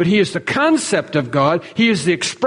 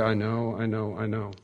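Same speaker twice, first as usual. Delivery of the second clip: low, soft, defeated mutter with the head bowed; ashamed mumble; 8 semitones lower, 12 dB quieter than usual. Pretend. A guilty believer mumbling back to the preacher, soft and defeated. Congregation bowing heads, admitting fault.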